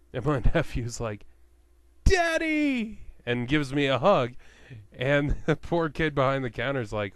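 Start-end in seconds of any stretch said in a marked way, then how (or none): 2.0-3.0 high, loud delighted cry of Daddy; gleeful kid shout. Retold or imitated. Nephew playing along, hugging his uncle, high excited shout. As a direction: squeal it like an overjoyed little kid.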